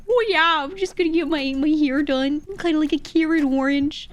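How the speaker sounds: high, loud, exaggerated Midwestern accent; mock American accent. Streamer parodying non-Northeastern Americans, hammed-up Midwestern accent. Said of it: "That's straight up how it sounds".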